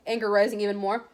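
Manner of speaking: ordinary delivery, nothing marked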